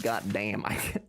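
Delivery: ordinary talk, nothing marked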